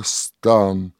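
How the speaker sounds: ordinary delivery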